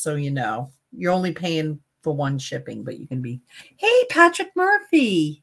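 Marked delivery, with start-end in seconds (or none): 3.8-5.4 excited greeting. Real, not acted. Greeting a viewer who joined.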